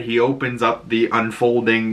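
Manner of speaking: normal talking voice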